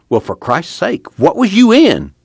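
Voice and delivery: acted astonishment, building to a high, loud question; incredulous, high-pitched outburst; astonished. A narrator's reading. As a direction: start slow, then shoot up in disbelief. Al reacts to Tom's battered face.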